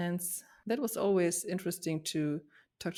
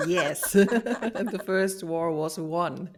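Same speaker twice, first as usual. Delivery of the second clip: laughing; 7 dB louder, much slower than usual. Real, not acted. Laughing at childhood victory.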